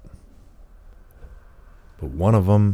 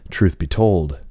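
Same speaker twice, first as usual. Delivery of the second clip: ordinary delivery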